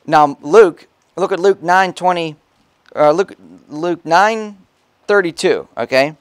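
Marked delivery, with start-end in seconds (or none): none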